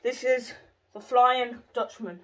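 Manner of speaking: slow, grand match commentary; commentator voice. Pretend. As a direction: announce it like a TV football commentator. Commentating own penalty shot.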